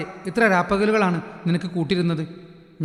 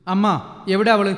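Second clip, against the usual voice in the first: louder, higher angry brother; angry demand; 4 semitones higher, 4 dB louder than usual. Acted. Dhruv demands his mother where she is, angry. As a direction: demand it, shaking with rage. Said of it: "ദേഷ്യം കൊണ്ട് വിറച്ചു".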